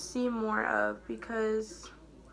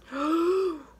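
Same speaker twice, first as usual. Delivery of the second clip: sharp, high intake of breath; real gasp, falling off; startled gasp; 5 semitones higher, 4 dB louder than usual. Real, not acted. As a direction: gasp at a sudden mishap. Sudden mishap while doing makeup.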